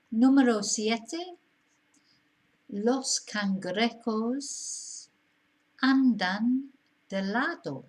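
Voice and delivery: slow, careful dictation of the sentence; slow model reading. Shown as a demonstration. Teacher dictating a Spanish exercise to students, slow and careful. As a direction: read it out slowly for the learner. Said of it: "teacher reading exercise sentence aloud".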